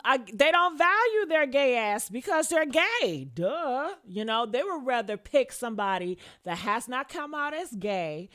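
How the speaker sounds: ordinary talk, nothing marked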